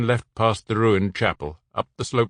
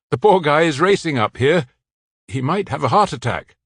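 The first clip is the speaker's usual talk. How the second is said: higher, louder, a man calling up the tower; Bruno's voice, urging; 6 semitones higher, 6 dB louder than usual. A narrator's reading. Bruno calling to the man on the tower, loud and higher. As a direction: call up to him, keep him talking.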